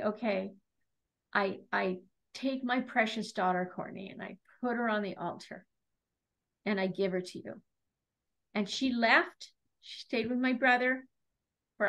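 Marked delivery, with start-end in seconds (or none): 0.0-7.6 soft, low, halting prayer; retold prayer, lowered and tender; hushed surrender. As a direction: hand a precious child over to God, quietly. Retold prayer surrendering her daughter.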